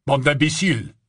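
gruff rebuke; rough tone. A narrator's reading. Barman scolding the young visitors, rough-toned. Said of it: "dit-il d'un ton rude".

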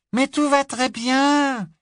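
put-on high, slow, carefree girl's voice; giggling little girl. Little girl reassures her sister, high and amused. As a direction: brush it off, amused. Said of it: "continuait à glousser de rire".

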